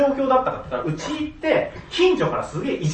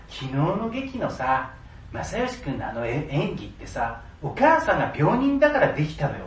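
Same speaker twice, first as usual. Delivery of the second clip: hushed, lower neighbour gossip; snide gossip; 7 semitones lower, 8 dB quieter than usual. Retold or imitated. Neighbour gossiping about the sick mother, hushed snide voice. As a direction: murmur it like a sneering neighbour.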